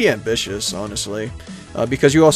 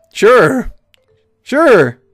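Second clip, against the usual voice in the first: exasperated; 7 semitones higher, 7 dB louder than usual. Real, not acted. Exasperated at only option.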